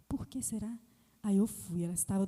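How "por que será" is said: soft unvoiced whisper. Nurse wondering in the dark room, whispering. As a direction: whisper it so the sleepers don't stir. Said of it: "Por que você tá falando baixinho?".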